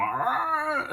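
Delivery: high-pitched imitated gearbox whine; mock engine scream. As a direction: screech like an overworked gearbox. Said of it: "the gearbox would be screaming".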